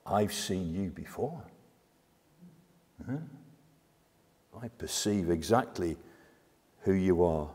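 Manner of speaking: low, soft, deliberate demon; hushed recognition. Acted. Demon telling Jesus it perceives him, low and hushed. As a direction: drop to a quiet, knowing tone.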